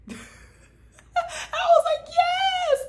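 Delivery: high squealing laugh-cry. Real, not acted. Vlogger reacting to Jimmy's line, laughing hard. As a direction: laugh it out, almost crying. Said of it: "laughing reaction".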